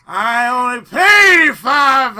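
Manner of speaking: loud, slow yell; sudden loud slow yell; shout. Real, not acted. Shouted outburst.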